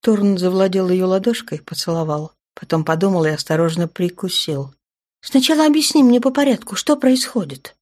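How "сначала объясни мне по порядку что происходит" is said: commanding. A narrator's reading. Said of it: "приказала женщина".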